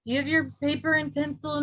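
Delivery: usual speaking voice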